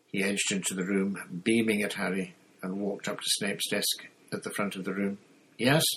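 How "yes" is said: short, curt questioning yes. A narrator's reading. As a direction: answer curtly, as a brief question. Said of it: "said Snape curtly".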